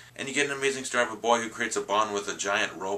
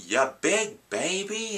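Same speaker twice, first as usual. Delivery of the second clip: much higher and very slow, a playful cartoon taunt; quoted cartoon voice; 10 semitones higher, much slower than usual. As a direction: stretch it out high like a teasing cartoon character. Quoting the movie's swimming scene.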